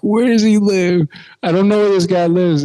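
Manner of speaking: loud, high retold panic; exasperated outburst. Teen stuck with a lost drunk stranger, exasperated outburst.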